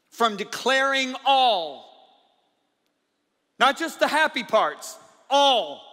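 loud, high proclamation with drawn-out key words; preacher hammering each phrase; full-force preaching. Acted. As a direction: thunder it out in emphatic bursts. Preaches Paul's declaration at full force.

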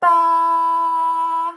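loud, long BA; sheep bleat. Acted. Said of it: "A sheep says".

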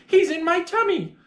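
put-on high-pitched, triumphant child; child's excited cry. A narrator's reading. Child proving the tummy beast to Mummy, excited cry. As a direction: exclaim as a child proved right. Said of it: "I cried".

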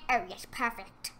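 ordinary delivery

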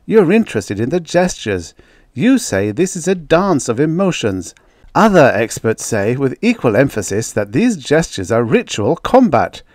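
brisk chairman setting out both sides; senior man moderating. Acted. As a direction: take charge of the argument diplomatically.

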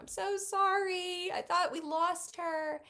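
high-pitched imitation of a tearful apology; retold sobbing apology. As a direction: re-enact apologizing through sobs, without real crying. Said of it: "fell into his arms sobbing".

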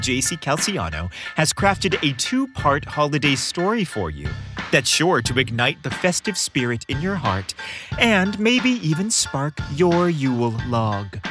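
ordinary delivery, nothing marked

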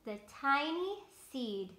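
lightly voiced slow storybook sun; sun character. Reading the sun's lines to children, lightly voiced. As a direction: read it slow and kind as the sun.

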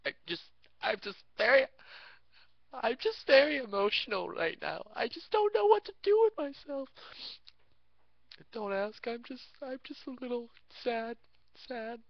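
fake emotional whine. Pretending to be a sad, sensitive guy, teary and high. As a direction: whimper it like he's about to cry. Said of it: "making myself cry because I'm talking like this".

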